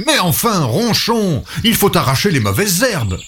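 a mild storybook scold; Léon exclaiming. A narrator's reading. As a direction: gardener Léon scolding his pig, mildly. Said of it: "Léon s'écrie".

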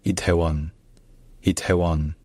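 Itaewon as "i-tè-won", ae as one è; Itaewon, Korean ae like French chèvre; correct three syllables. Shown as a demonstration. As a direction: say Itaewon with a lightly aspirated t and open e. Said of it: "dites juste".

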